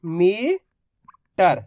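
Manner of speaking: very slow, stretched syllables; drawn-out word. Shown as a demonstration. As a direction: draw the word out so each letter is heard. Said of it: "तो मिलके बन गया अपने". (शब्द को धीरे-धीरे खींचकर बोलना)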